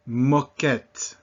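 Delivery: moquette, stress landing on the last syllable; French open O. Shown as a demonstration. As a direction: model moquette clearly in French, open first vowel. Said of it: "we say moquette, O".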